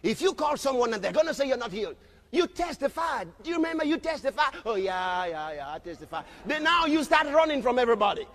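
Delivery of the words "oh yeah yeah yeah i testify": mock sheepish mumble. Mocking the believer.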